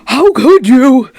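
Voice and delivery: loud, high, slow appalled cry; hammed-up betrayed shriek; mock outrage. Mock outrage at own mistake.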